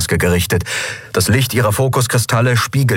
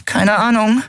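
answering character voice. Acted. Figur antwortet im Dialog.

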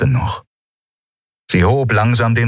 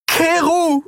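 high, desperate shouting plea; desperate cry. A narrator's reading. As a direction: cry out to stop him. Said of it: "rief sie verzweifelt".

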